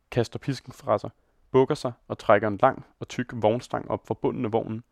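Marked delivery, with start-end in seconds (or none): none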